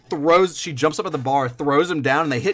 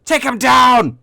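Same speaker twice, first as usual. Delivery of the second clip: loud, much higher command shout; battle order; 12 semitones higher, 8 dB louder, slower than usual. Acted. Knight commands his men, loud high shout. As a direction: bark it over the chaos.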